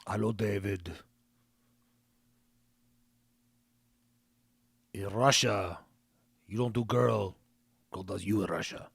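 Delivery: low, deep-voiced Russian woman accent; Russian accent impression. Mimicking the girl on the rent video call in a deep Russian accent. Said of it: "Kinda how she sounds".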